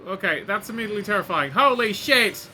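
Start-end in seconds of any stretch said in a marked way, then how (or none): 1.5-2.5 loud, high burst of real alarm; startled shout. Real, not acted. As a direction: blurt it out as the ride plunges. Coaster suddenly drops terrifyingly.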